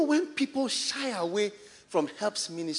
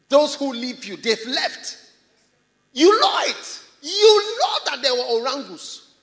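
loud, high preacher's cry; preach; 6 semitones higher, 9 dB louder than usual. Acted. Preacher to the church about those who left, forceful preaching. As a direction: drive it home to the congregation at full volume.

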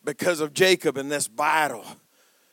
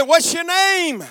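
high, loud, drawn-out demand; demanding shout; 10 semitones higher, 6 dB louder, slower than usual. Acted. God questioning Jacob as they wrestle, demanding shout. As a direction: call it out as a commanding question.

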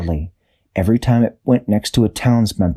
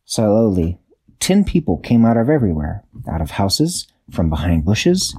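ordinary delivery

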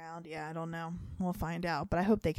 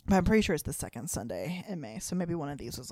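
ordinary delivery

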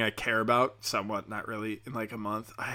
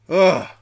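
loud, exasperated "ugh"; disgusted groan; 6 semitones higher, 12 dB louder than usual. Real, not acted. Disgust at hated customer.

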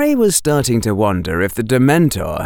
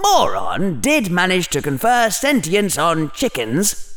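higher, swooping voice of a scheming debater; inner Slytherin, persuasive; 9 semitones higher than usual. Acted.

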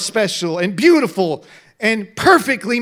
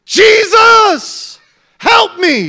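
high, loud shout for help; 12 semitones higher, 11 dB louder, much slower than usual. Acted. Blind man shouting to Jesus for help, full shout. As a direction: cry out with everything. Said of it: "he began to shout".

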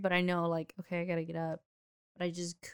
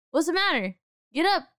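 impatient dad; 10 semitones higher, 6 dB louder than usual. Retold or imitated. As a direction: imitate a father's loud, high demand. Retold dad ordering child up.

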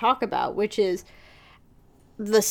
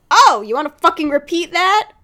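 angry challenge; 7 semitones higher, 6 dB louder than usual. Retold or imitated. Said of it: "Alex is just kinda like".

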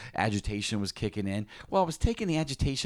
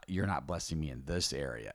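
corrected, softer; 6 semitones lower, 8 dB quieter than usual. Shown as a demonstration. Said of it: "correct statement or the accurate statement".

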